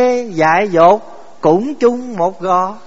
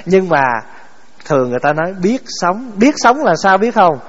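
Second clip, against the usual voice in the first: usual speaking voice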